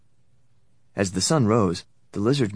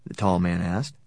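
usual speaking voice

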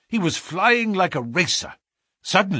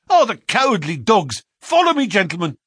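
loud high frantic cries; frantic shouting; 11 semitones higher, 5 dB louder than usual. A narrator's reading. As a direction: cry out in frantic despair.

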